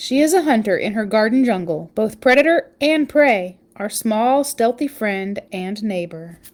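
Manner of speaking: lively read-aloud storytelling voice; story narration. Acted. Parent reading a spider book to listeners, storytelling voice.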